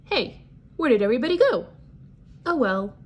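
puzzled shark. Acted. Teacher voicing the shark, lightly surprised. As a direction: toss it off as a confused shark.

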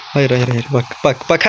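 usual speaking voice

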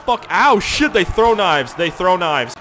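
loud, high cries under attack; frantic high-pitched warning; alarmed shouting. Real, not acted. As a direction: yell in startled panic. Hit by thrown knives in game.

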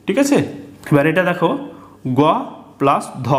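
ordinary delivery

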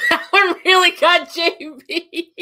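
high, breathless real laughter through the words; cracking up at a joke about a coworker; laughing hard. Real, not acted. Host laughing helplessly on stream.

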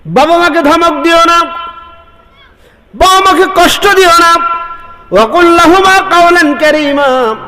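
high, ringing sermon cry; fiery preaching. Acted. Preacher calling to the congregation, fiery and high. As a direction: preach it out to the crowd at full force. Preacher rises to full force.

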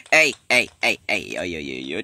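ordinary talk

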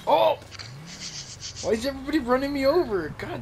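high, exasperated outburst; exasperated cry. Real, not acted. Gets run over in game.

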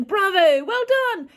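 high, loud cheer; the whole school shouting bravo. Retold or imitated.

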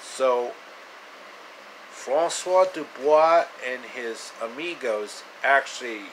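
normal talking voice

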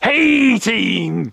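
high, drawn-out Devon call; Devon accent. Shown as a demonstration. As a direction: show off your best Devon accent on a rallying catchphrase. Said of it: "in your best Devon accent".

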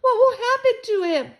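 high, loud anxious wail; crying with worry. A narrator's reading. Girl afraid for the hurt boy, wailing. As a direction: cry out the question in panic. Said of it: "Sheila cried".

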